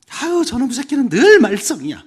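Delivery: high, loud acted grumbling; exasperated parent. Parent judging a child by the past, exasperated grumble. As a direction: complain loudly with hammed-up exasperation.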